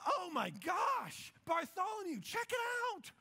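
high, loud mock-astonished shout; hammed-up amazement. Peter showing Bartholomew the fish with money, hammed-up excited shouting. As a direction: shriek it like a giddy, starstruck disciple. Disciple acted out finding money.

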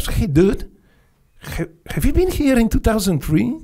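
army guy amazed to see him; curious army guy. Retold or imitated. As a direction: quiz him with high surprise.

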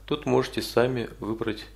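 usual speaking voice

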